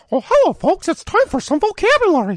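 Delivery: chirpy cartoon mouse greeting; Mickey Mouse voice. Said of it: "Oh, do it again".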